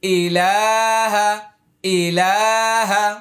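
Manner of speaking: slow, drawn-out Quranic recitation. Shown as a demonstration. Teacher modelling the phrase for learners to memorise, slow chant. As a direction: draw each vowel out like a reciter. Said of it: "আবার আয়না ধরুন".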